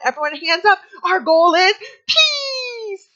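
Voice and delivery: high, loud and slow, stretching out peace; preschool teacher voice. Retold or imitated. Teacher leading circle time, high, loud and drawn-out. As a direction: cheer the kids' hands up. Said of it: "And I'd be like".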